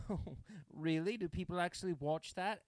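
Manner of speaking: high, incredulous mock British toff; posh British accent. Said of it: "these two British people".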